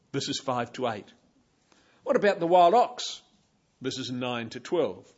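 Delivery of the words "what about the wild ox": loud, raised mocking question; sarcastic. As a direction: toss out the jab. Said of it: "tone of sarcasm".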